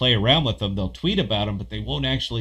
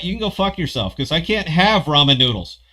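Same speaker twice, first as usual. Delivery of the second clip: higher, louder fake outrage at a viewer; mock rant; 7 semitones higher, 4 dB louder than usual. Host rants at a viewer over ramen, mock angry and loud. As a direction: tear into him in joking fury. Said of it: "you know I'm busting balls".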